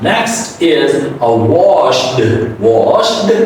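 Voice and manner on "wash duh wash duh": 'washed' with an added 'duh'; wrong D ending. Shown as a demonstration. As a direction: say 'washed' as wash-duh. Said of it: "pronounce final D as a D".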